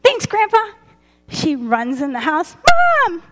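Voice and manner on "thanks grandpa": put-on excited little girl. Girl thanking grandpa excitedly.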